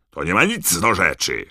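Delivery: father's exasperated roar. A narrator's reading. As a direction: bellow to shut the argument down. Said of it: "ryknął pan Weasley".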